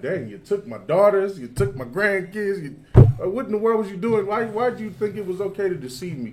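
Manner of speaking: a touch of angry Laban; upset Laban. Retold or imitated. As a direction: voice Laban's hurt outrage. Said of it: "He's like".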